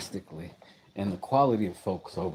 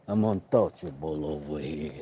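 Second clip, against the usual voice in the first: put-on a lower second stab at "I'm untouchable over here" in a British accent; lower British accent try; 5 semitones lower than usual. Streamer practising a British accent on camera, lower mock British. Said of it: "I'm working on that accent".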